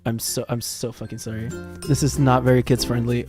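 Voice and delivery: ordinary delivery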